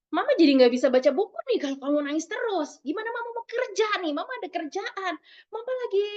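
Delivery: high-pitched complaining mother; disapproving parent. Acted. Mother complaining to her crying child that she has work, high-pitched scolding. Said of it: "ada lagi disapproving parent".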